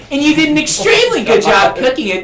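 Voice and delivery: ordinary talk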